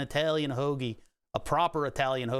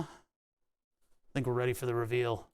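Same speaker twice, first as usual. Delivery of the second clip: slow, softer, lower announcement; dramatic reveal voice; 4 semitones lower, 4 dB quieter, slower than usual. Pretend. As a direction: build suspense in a hush.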